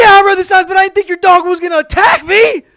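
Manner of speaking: very high, loud acted yell; indignant screaming. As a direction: scream back in hammed-up outrage. Screams back at the homeowner.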